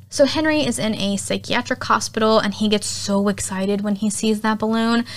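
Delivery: ordinary delivery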